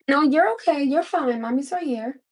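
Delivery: calm the little one down. Real, not acted. Mother reassures her child.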